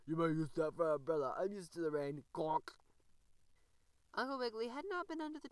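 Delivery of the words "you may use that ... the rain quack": grunting frog. A narrator's reading. The frog offers the rabbit his toadstool, grunting. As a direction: grunt it out like a friendly old frog. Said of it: "grunted Mister Croaker".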